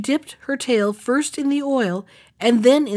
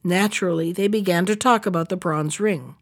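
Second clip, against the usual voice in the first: usual speaking voice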